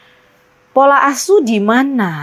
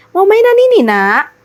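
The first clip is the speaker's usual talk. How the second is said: put-on coaxing a toddler; 8 semitones higher, 3 dB louder than usual. Offering toy to a toddler.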